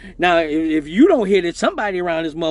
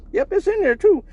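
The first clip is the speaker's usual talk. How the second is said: higher, slightly put-on voice; a lightly voiced stunned guard; mock guard voice; 12 semitones higher than usual. Guard steps back to look, a high mock confirmation.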